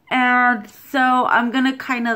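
squeezing own cheeks while talking; speaking with face held; hands pressed on cheeks. Real, not acted. Seen 2: Hands mostly press cheeks; cover mouth only briefly near 0.4s.

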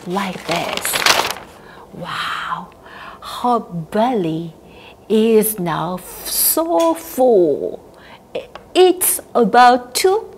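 ordinary delivery

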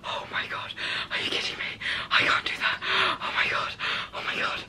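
shocked whisper. Real, not acted. Shock after pulling wax strip.

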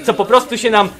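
ordinary delivery

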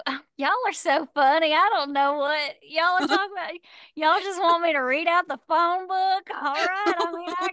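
put-on thick Louisiana accent. Imitating husband's sister asked to perform, thick Louisiana accent. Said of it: "Her accent is thick".

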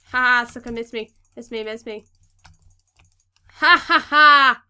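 loud gloating taunt, big laugh; dunk tank heckler. Real, not acted. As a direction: jeer at them like a carnival heckler. Taunting mob that missed.

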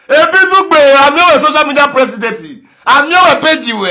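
loud teasing call with laughs; mock him while laughing; laughing taunt. Real, not acted.

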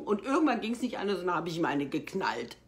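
ordinary delivery

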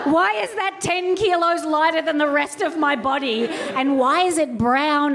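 rising horror at the arm; appalled. Retold or imitated. Horrified at uncovered arm.

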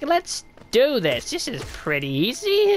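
cocky bravado. Retold or imitated. Streamer imitating their early overconfidence, cocky and slow. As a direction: play the naive cocky newbie. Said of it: "Early on, I was just like".

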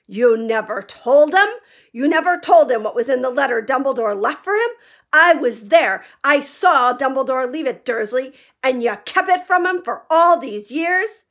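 every syllable shaking with rage; trembling with rage. A narrator's reading. Hagrid furious at Vernon for hiding the letter. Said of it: "his every syllable trembled with rage".

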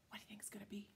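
hushed, secret whisper. Real, not acted. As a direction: lean in and whisper the question. Suspense question to child.

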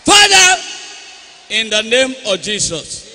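loud, high praying, shouted in the name of Jesus; fervent prayer. Real, not acted. Pastor praying healing over the boy, loud fervent prayer. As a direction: pray over him at full force.

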